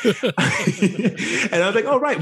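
high laughing voice. Real, not acted. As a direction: laugh through the words.

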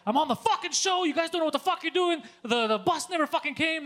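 re-enacted indignant rant; retold yelling. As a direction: blow up at the backstage lady. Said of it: "he's just yelling all the time".

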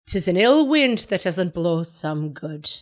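put-on slow, lilting Irish accent on the old saying. Shown as a demonstration. Quoting grandmother's old expression to a listener, in a slow Irish accent. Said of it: "It sounds better if you say it in an Irish accent".

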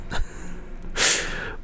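bursting out laughing; real helpless laugh. Real, not acted. Narrator laughing.

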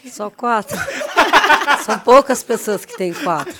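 laughing through the brag. Real, not acted. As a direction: laugh while bragging. Jokes about her four titles.

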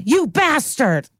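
a retold dramatic scream, drawn out; mock scream. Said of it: "she gets to do a dream and scream".

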